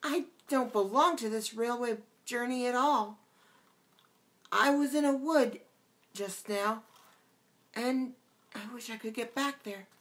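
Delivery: put-on a small girl insisting she doesn't belong here; young Alice, put out. A narrator's reading. Alice's voice in the story.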